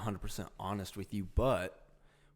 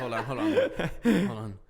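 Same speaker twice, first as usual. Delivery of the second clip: high giggly hold on; laughing through the words; 10 semitones higher, 9 dB louder than usual. Real, not acted. Laughing while composing himself.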